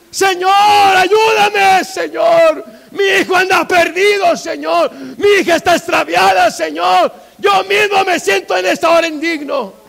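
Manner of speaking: high, loud, anguished shouted prayer; desperate full-force plea with stretched opening; crying out in prayer. Shown as a demonstration. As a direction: shout your troubles up to God. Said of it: "usted clame si tiene que gritar".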